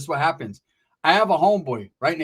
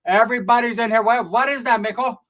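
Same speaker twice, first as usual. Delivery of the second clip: higher, loud, surprised aunt; higher auntie voice exclaiming; aunt's voice; 6 semitones higher, 6 dB louder than usual. Retold or imitated. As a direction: play the auntie shocked at the crowd. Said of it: "my tia's like".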